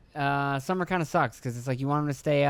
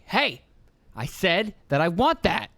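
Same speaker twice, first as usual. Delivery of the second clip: higher, slow bossy insistence; demanding kid; 4 semitones higher, slower than usual. Retold or imitated. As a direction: imitate a toddler making demands. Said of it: "my son is really starting to get like".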